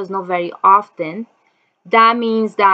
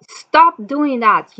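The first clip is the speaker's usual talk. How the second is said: 1 retold shout at the door; 6 semitones higher, 5 dB louder, slower than usual. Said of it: "they start saying".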